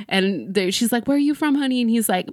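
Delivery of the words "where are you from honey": high, sugary call to a fan; sweet diva question. Retold or imitated. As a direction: coo at the fan from the stage. J Lo asking a fan.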